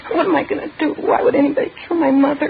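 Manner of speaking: high-pitched, anguished pleading questions; distraught. Acted. Daughter asks detectives about her murdered mother, distraught. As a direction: plead helplessly as grief wells up.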